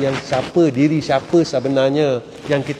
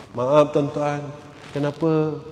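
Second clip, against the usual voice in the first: ordinary talk, nothing marked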